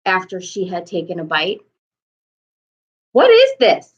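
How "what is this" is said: high, loud outraged roar. A narrator's reading. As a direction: bellow at the baker. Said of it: "roared the Duke".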